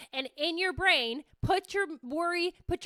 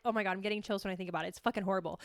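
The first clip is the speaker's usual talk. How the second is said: dreading aside; 6 semitones lower, 5 dB quieter, much faster than usual. Real, not acted. Dreading cold shower, aside.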